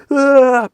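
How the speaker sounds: loud, high mock cry of pain; imitated pained cry. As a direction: wail like a burned mouth. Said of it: "panicking, crying in pain".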